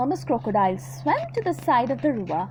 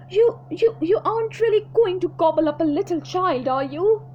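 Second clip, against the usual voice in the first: higher, louder frightened monkey; stammering fear; 5 semitones higher, 4 dB louder than usual. Acted. Monkey shaking at the crocodile, frightened stammer. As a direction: stammer in terror.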